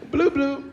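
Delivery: high, quick electronic boop; imitated card-reader beep. Girl swipes the gift card, imitated beep. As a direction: mimic a machine's high two-note beep.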